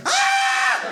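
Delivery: shrill high frightened shriek; haunted house scream. Retold or imitated. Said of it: "We screamed like we were in a haunted house".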